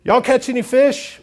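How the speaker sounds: a high, loud Southern-flavoured holler; folksy call. Acted. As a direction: holler it out to the boat.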